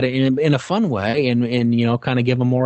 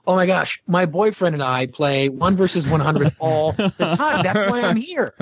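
lightly voiced gushing young fan, higher; 5 semitones higher than usual. Fan tells the host she plays his game, lightly higher gushing. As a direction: gush like a thrilled fan. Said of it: "She goes".

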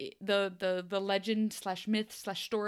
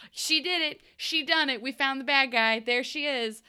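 mock accusation; 6 semitones higher, 4 dB louder than usual. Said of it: "I was just like".

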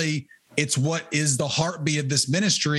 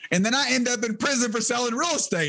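laughing; 7 semitones higher, faster than usual. Real, not acted. Laughing at the irony.

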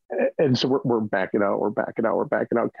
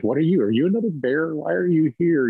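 lightly voiced bear, raised pitch and puzzled; confused bear; 7 semitones higher than usual. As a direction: play the nearsighted bear squinting up at a stranger. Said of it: "they're kinda like".

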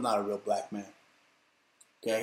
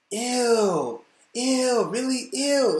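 disgusted; 8 semitones higher, much slower than usual. Real, not acted. Disgust at Michelle Obama.

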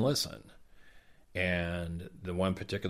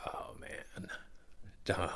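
soft aside; 9 dB quieter, slower than usual. Retold or imitated. Santa's reaction to the child.

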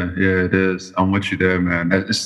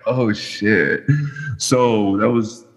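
ordinary delivery

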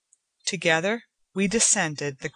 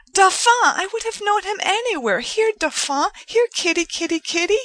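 much higher and louder, fast eager calling of a cat; excited calling; 14 semitones higher, 6 dB louder than usual. A narrator's reading. Joanna calls the cat to her, much higher, louder and excited. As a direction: call the kitty over with glee.

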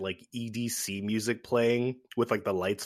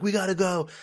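urgent; 9 semitones higher than usual. Retold or imitated. Character urging sleeping driver.

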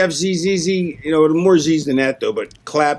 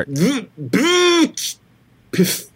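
pff; 6 semitones higher, much slower than usual. Pretend. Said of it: "No, B V V V V".

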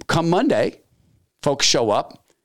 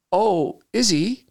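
slow, high, feigned surprise; mock surprise; 8 semitones higher, much slower than usual. Boss to admin about union man, slow high mock surprise. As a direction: act surprised, drawing it out.

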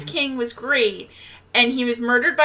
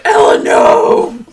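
loud, slow, breathy hammed-up cry of alarm; a mother's mock panicked yell; mock alarmed shout; 9 dB louder, slower than usual. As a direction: shout her name like a mother catching her child mid-mischief. Mom's alarmed cry quoted in recap.

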